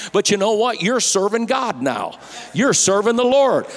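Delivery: high and loud, building to a climax; fiery climax of a sermon; full-force preaching. Real, not acted.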